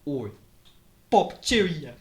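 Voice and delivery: high, hammed-up British; British accent. Shown as a demonstration. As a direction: pitch it up in mock British. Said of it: "do a British accent for us right now".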